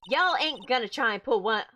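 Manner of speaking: feisty Southern local spoiling for a fight; Southern accent. Acted. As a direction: warn them off in a broad put-on Southern accent. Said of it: "get my best southern accent on".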